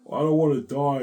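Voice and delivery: high, loud retold plea; mock-anguished cry; crying protest. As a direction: act out a distressed outcry. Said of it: "I was crying too".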